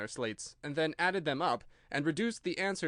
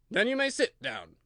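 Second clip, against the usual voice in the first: higher, louder stern King; a king scolding his subject; loud reproving royal voice; 10 semitones higher, 6 dB louder than usual. A narrator's reading.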